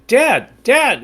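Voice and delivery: put-on urgent kid calling. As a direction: tug at dad with your voice. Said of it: "I keep pushing".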